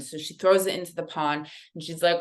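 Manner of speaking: normal talking voice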